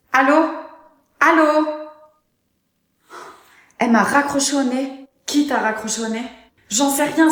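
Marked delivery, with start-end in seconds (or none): 0.1-1.7 high, loud repeated hello into the phone; loud calling out. Acted. Appel raccroché au nez. 6.7-7.3 flustered. Acted. Mariée affolée.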